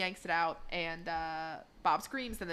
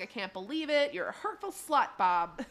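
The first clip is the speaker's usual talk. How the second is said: retold angry telling-off, pitched up; pissed off; 5 dB louder than usual. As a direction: act out outraged disbelief. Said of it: "And Linda's pissed".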